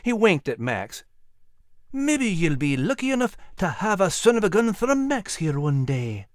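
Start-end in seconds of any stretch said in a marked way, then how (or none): 1.9-6.2 put-on high-pitched Scottish old soldier; lilting Scottish greeting from an old soldier; genial old Scottish sergeant.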